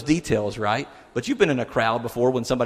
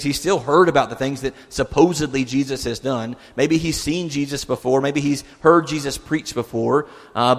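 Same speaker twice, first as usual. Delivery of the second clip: ordinary talk, nothing marked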